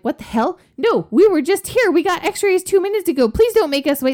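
high, loud pleading protest; exasperated dad. Retold or imitated. Dad pleads with hospital staff, loud and exasperated. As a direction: beg them not to make you wait again.

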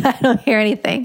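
repeating it through a small laugh; flustered self laughing as she insists; laughing denial. Retold or imitated. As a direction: laugh it off in disbelief.

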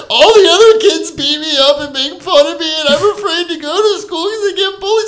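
high, loud mock-tearful whine; hammed-up sob story for the judge; played-up crying. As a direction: plead like a bullied kid faking tears. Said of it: "I just played it".

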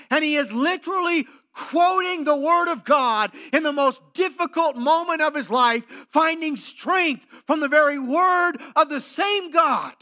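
fervent preaching. Acted. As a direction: preach it out with full conviction. Preaching at full force.

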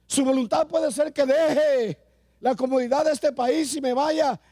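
high and intense, building pulpit voice; fervent preaching. Acted. Preacher urging the congregation toward God's will, fervent and high. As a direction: drive it home to the congregation.

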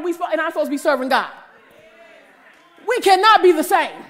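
high, loud preaching with laughs; preaching at full force. Acted. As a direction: preach it to the congregation.

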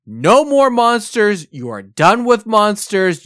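a father banning monsters for good; stern dad. Retold or imitated. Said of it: "my dad basically said".

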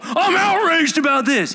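mock an angry person blowing up; angry outburst. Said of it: "Angry people".

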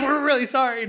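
high, loud mock apology from the family; embarrassed apology. Family apologises to the psychologist, high loud hammed-up voice.

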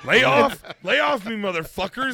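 high loud laughing yell; coked-up yell. Acted. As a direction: yell it like a defensive addict. Acting coked-up Mulaney.

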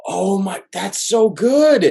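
high-pitched, drawn-out fan rapture; retold gushing delight. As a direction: re-enact being blown away, voice soaring. Acting out own delighted change of mind.

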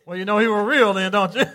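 loud, laughing aside. Real, not acted. Pastor laughing with his church at his own imitation. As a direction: laugh it out to the crowd.

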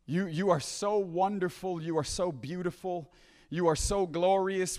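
low, soft address to God; awed worship. Acted. Preacher voicing heaven's worshippers before the throne, awed and soft. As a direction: reach haltingly for words of adoration.

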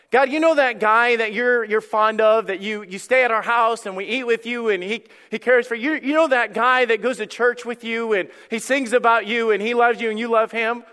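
earnest appeal. Acted. Voices sisters pleading to God.

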